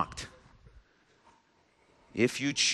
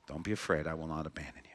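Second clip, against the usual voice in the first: soft, lower, near-whispered reassurance; God's gentle hush, much softer; tender comfort; 10 semitones lower, 14 dB quieter, much faster than usual. Acted. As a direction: lean in and soothe a frightened child. God comforting the afraid listener.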